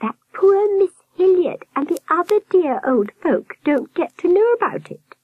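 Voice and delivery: high falsetto, not truly hushed; confiding nurse. A narrator's reading. Nurse confiding about the old folk, high warble. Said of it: "she lowered her voice".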